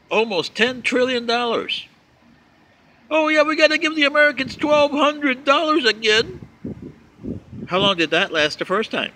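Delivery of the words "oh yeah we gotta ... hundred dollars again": high, louder mocking voice of the other party; mock politician voice. Vlogger mocking Democrats over stimulus checks, high sarcastic voice. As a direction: parody them in a silly high put-on voice.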